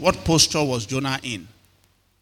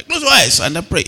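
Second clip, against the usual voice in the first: higher, insistent mock teacher order; scolding teacher; 6 semitones higher, 3 dB louder than usual. Sunday school teacher orders children to pray, high mock scolding. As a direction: imitate a teacher hurrying pupils into prayer. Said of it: "And then the teacher is looking".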